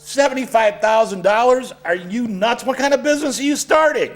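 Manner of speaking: high, incredulous squawk at the price; can't believe the number he heard; John balks at the seventy-five grand demand; shocked disbelief. Acted. Outraged at the price asked.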